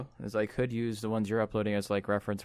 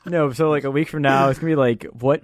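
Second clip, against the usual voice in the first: laughing; 4 semitones higher, 7 dB louder, slower than usual. Real, not acted. Laughing over the countdown.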